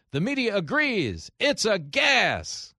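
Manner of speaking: high, loud hype blurb, last word stretched out; over-the-top fake rave; mock rave review. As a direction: belt it out like a gushing movie-trailer quote. Twisted movie blurb, acted as rave.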